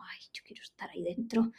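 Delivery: soft longing whisper. Retold or imitated. Longing for the sofa.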